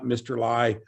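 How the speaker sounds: ordinary delivery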